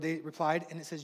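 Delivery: usual speaking voice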